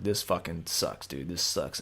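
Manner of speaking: muttered low and soft, slowed down; low despairing mutter. Retold or imitated. Alone in the car after the X-ray, low soft muttering. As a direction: grumble it to yourself, deflated. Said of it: "I'm like".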